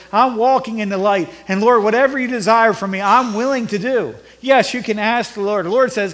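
fervent sermon delivery; preaching. Acted. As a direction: preach it out to the congregation. Preaching on prayer.

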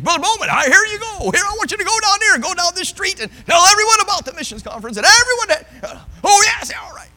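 high, loud, excited pastor rushing instructions; pastor voiced. Pastor excitedly sending the preacher down the street, high and loud. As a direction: play the eager pastor sending him out.

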